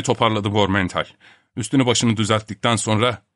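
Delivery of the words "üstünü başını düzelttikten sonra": usual speaking voice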